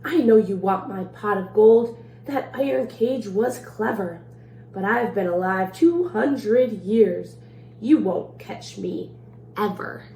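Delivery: lively storybook read-aloud in the leprechaun's rhymes; reading to children. A narrator's reading. Librarian reading a leprechaun rhyme to children, playful read-aloud. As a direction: read it like a bedtime story for kids. Reads picture book to children.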